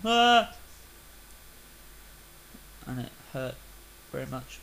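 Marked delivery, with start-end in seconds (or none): 0.0-0.5 sudden loud, high cry; falling yelp. Retold or imitated. Gamer re-enacting digging down, sudden yelp. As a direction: yelp as if dropping down a hole.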